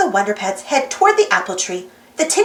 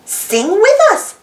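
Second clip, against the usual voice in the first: cheerful invitation; 7 semitones higher, 4 dB louder, slower than usual. Acted. Inviting the listener to sing.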